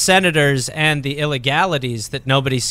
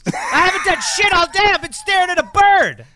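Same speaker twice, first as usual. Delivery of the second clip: much higher, crying shout; mock wail; 13 semitones higher than usual. Comedian playing a bored old-timer, in a mock tearful wail. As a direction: whine it out like a bored kid breaking down. Joking exasperated whine.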